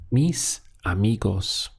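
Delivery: "mis amigos" with a clear gap after mis; "mis ... amigos", measured, each word on its own. Shown as a demonstration. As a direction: separate mis from amigos for a learner. Teacher models phrase word by word.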